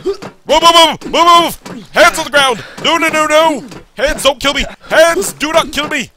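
high, loud, frantic pleading; urgent panicked shouts; panicked yelling. Real, not acted. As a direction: yell in alarm as if under attack. Panicking as hands attack in game.

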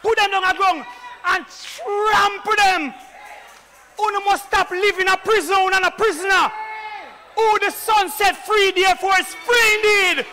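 loud, slow, ringing pulpit proclamation; full-force preaching. Acted. Preacher thundering the resurrection to the congregation, full-force preaching.

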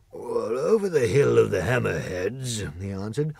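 a grand beast dreaming of his kingdom; Lion voice. Acted. Lion voiced with other timbre.

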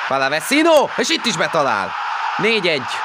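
excited goal call. Real, not acted. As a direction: shout the goal out. Goal call in live commentary.

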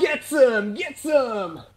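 battle cry. Real, not acted. Hyping the team in combat.

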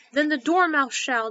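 loud, slow, drawn-out call; crying out. A narrator's reading. Both volunteer the sleeping Dormouse, crying out. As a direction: cry it out with force. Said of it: "they both cried".

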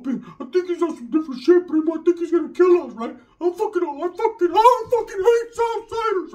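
panicky. Retold or imitated. Stoned cousin whispering his fear behind the porch, high panicky voice. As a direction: sell the paranoia in a squeaky voice.

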